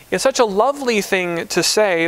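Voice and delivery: ordinary talk, nothing marked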